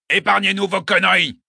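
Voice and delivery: put-on gruff soldier with a Retz accent. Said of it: "une voix qui avait l'accent du Retz".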